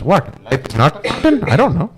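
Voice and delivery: the wise man caught out and backpedalling; flustered guru. Retold or imitated. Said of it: "And the guru goes".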